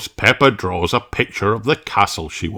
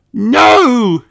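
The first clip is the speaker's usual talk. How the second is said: put-on high, loud child shout; 6 dB louder than usual. Peppa shouting at Mister Zebra, high and loud. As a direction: shout to stop him taking the box. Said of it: "shouts Peppa".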